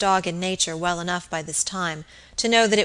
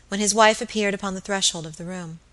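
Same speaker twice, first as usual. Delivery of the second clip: ordinary delivery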